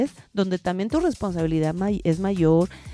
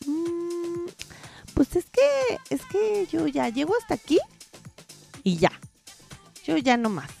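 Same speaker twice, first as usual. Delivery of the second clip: whiny quote; 5 semitones higher than usual. Retold or imitated. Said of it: "que dicen así como de".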